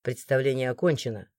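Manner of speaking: low, quiet authority; deep, controlled guard's voice; order, voice unraised. A narrator's reading. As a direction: dismiss them calmly but firmly. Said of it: "не повышая тона, приказал стражник".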